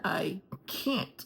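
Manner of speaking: "can't" drawn out slowly for emphasis; stress on can't. Retold or imitated. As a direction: lean hard and slow on "can't". Contrasts can't with don't want.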